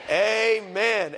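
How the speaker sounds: usual speaking voice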